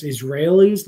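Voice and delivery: ordinary delivery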